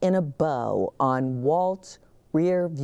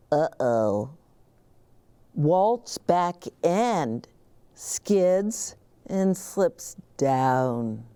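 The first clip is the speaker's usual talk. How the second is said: ordinary delivery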